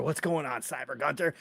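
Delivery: ordinary talk, nothing marked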